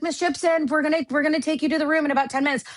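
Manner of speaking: high, quick event-staffer voice; imitated staff call. Staff summoning a panelist in the bathroom, imitated high businesslike call.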